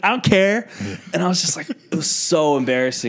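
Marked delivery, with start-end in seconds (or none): 0.0-0.7 high, dismissive Southern nurse; sassy Southern retort, imitated; southern accent. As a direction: brush it off with a Southern twang. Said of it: "southern accent".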